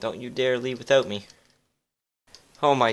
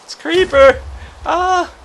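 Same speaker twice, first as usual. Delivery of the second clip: panicked scream; 13 dB louder, slower than usual. Acted. Creeper appears.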